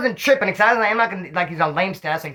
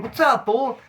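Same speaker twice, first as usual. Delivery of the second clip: excited greeting; 8 semitones higher, slower than usual. Retold or imitated. Greeting old cellmate.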